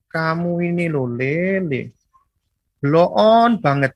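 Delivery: slow Central Javanese drawl with a sting; slow, drawn-out. Shown as a demonstration. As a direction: stretch each word out slowly, gently needling. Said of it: "bicaranya pelan". (pelan dan lambat tapi menusuk)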